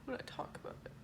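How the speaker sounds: barely voiced admission through tears; tearful whisper. Real, not acted. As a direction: let it fall to a whisper. Emotional confession, voice drops.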